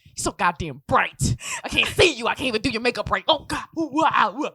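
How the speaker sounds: mock berating that builds into loud wails; berating mom. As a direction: scold like a mom, then recoil loudly. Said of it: "lecturing me and berating me like a mom".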